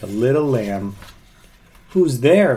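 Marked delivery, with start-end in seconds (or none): 1.9-2.6 high loud call. A narrator's reading. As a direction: call through the door. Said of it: "called the second little pig".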